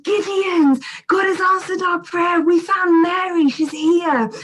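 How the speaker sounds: calling out the news. Retold or imitated. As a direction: announce it to the whole house, ringing out. Newton calls out to the children.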